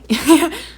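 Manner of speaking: high, loud burst of laughter; real laugh. Real, not acted. Vlogger laughing hard at her own story, loud and high. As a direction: crack up for real. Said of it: "you're making me laugh really hard".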